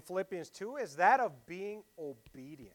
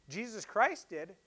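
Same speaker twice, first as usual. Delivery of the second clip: usual speaking voice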